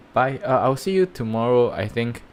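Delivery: ordinary talk, nothing marked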